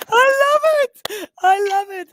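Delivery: high, loud delighted squeal. Real, not acted. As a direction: gush with real delight. Delighted by Afrikaans reply.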